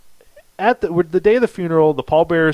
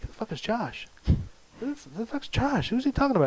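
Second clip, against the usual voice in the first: high, hushed mock bewilderment; baffled aside; 9 semitones higher, 8 dB quieter than usual. Pallbearer to himself at the funeral, baffled and hushed. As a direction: puzzle it out under your breath, pitched up.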